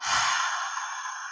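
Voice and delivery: soft long breath out; relaxing release of breath; long exhale demo. Shown as a demonstration. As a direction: let it all out slowly. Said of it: "my exhales were like".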